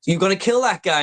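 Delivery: lightly voiced young John; mock kid question. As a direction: squeak it like an alarmed boy. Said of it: "what do the".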